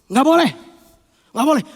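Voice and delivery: short, forceful refusal; firm forbidding. Acted. Strong spirit forbidding the tempter, firm and forceful. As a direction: shut it down sharply. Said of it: "Ini bilang".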